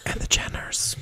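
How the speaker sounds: whispered, hushed afterthought; stage whisper. Pretend. Host finishing an aside to listeners, whispering. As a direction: add it in a secretive whisper.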